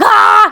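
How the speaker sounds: short high, loud mock scream; imitated scream of rage; mad scream. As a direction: scream like you're furious. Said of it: "He, like, screams. He's so mad".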